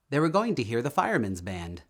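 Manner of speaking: ordinary delivery, nothing marked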